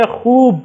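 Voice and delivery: خوب with the واو drawn out, a long open oo; open stretched vowel. Shown as a demonstration. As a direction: lean on the oo in خوب. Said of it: "واو کو تھوڑا سا کھینچ کر اور کھل کر ہم پڑھتے ہیں".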